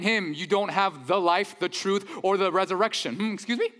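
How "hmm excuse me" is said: higher, questioning disbelief; taken aback. Acted. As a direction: act the startled listener, rising at the end. Voicing shocked hearers of Jesus' claims.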